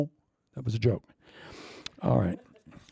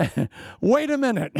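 high, laughing mock protest; 11 semitones higher than usual. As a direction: cry out half-laughing in mock outrage. Protesting wife throwing strawberries.

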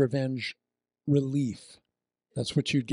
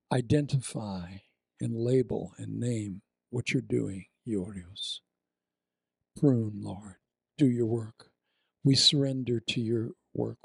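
prayer; 2 semitones lower than usual. Acted. Prays over the congregation.

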